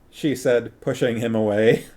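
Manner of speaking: ordinary delivery, nothing marked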